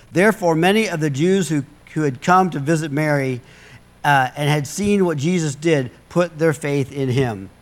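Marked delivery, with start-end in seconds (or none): none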